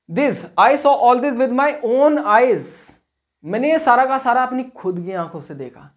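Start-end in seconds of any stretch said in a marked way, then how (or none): 0.1-2.7 drawn-out word by word for copying; slow dictation. Shown as a demonstration. Teacher dictating an example sentence slowly to students. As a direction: spell it out slowly for the class. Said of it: "dictating example slowly".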